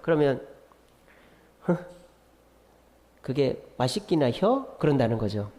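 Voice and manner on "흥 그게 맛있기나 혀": slow, dry Chungcheong drawl with a scoffing huff; mock a Chungcheong customer, slow and noncommittal; Chungcheong dialect. Chungcheong customer brushing off a seller, slow dry dialect. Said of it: "그 충청도 분들이 뭐 그런다지 않습니까?".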